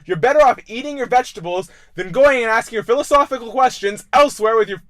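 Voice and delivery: put-on loud, high scolding of a kid; mock lecturing shout. Podcast host scolding a kid, loud and high. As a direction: harangue the kid about vegetables. Comedic rant at a child.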